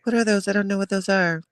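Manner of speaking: Janet imitation. Mocking Janet's interview answer, high and puzzled. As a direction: play Janet acting clueless. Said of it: "Janet was like".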